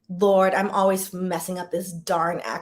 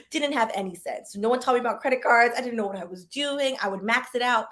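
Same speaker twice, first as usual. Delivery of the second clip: usual speaking voice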